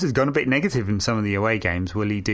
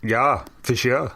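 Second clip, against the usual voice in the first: put-on Dutch accent; slower than usual. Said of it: "the most I'll ever do in a dodgy Dutch accent".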